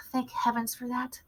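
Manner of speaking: soft whispered sigh of relief. A narrator's reading. Narrator whispers with relief, hidden in the hall. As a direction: breathe it out under your breath.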